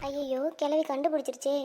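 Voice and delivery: very soft, dismayed mutter; hushed aside. Acted. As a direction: mutter it secretly to yourself. Secret aside on being found out. (மெதுவான குரலில் தனக்குள் முணுமுணுப்பு)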